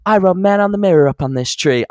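high celebrity impression. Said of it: "he's just like".

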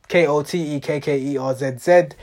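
ordinary delivery

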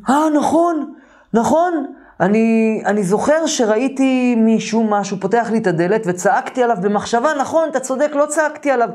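retold high-pitched burst of remembering; sudden realisation. Roommate remembers the night's events, in high-pitched realisation. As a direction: act out a memory flooding back.

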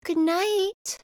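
high-pitched acted girl's goodnight; girl speaking up. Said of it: "she says".

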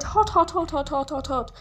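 high, rapid-fire yelps; yelling. A narrator's reading. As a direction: yelp as if burning your hands. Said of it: "he yelled".

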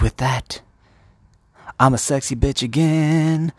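high, loud mock fanfare; triumphant transformed wife. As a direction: belt it out as a grand reveal. Wife announces her transformation.

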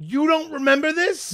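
high-pitched disbelieving question; incredulous. Real, not acted. Disbelief that friends don't recall it.

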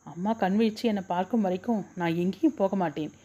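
plain reading voice

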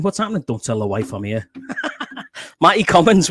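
normal talking voice

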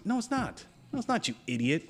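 very high, sharp no it's not; incredulous rebuttal. Acted. Shutting down someone who says democracy, incredulous. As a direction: shoot it down in disbelief.

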